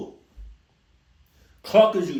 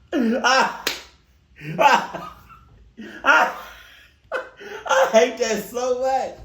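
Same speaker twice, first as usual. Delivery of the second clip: giggling through the words; laughing; 5 semitones higher, slower than usual. Real, not acted. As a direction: laugh out each word. Laughing fit after impression.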